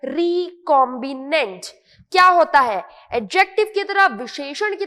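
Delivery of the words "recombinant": recombinant drawn out as "ree-com-bi-nent"; careful pronunciation. Shown as a demonstration. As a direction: teach recombinant slowly, part by part. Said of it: "correctly pronounce करना सीखना है". (recombinant को धीमे, साफ़ "री-कॉम्बि-नेंट")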